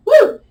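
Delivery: loud, high "woo" on release; relieved whoop. Real, not acted. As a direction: let out a big high whoop.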